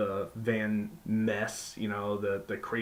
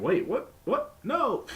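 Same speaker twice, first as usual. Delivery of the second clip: much higher, hammed-up confused double take; surprised announcers; 13 semitones higher than usual. Announcers catching the early bell, mock high surprise. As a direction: squeak out baffled disbelief, then catch on. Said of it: "were saying".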